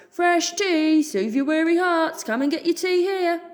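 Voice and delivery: high, loud hawker's cry; calling out. Acted. Tea seller calling to the market crowd, loud. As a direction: bellow your wares over the crowd.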